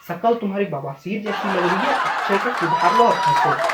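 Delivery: starts teasing, then climbs into a squeaky laughing falsetto; mocking dad's thoughts. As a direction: ham up dad's secret mockery until it cracks into laughter. Dad's imagined inner thought.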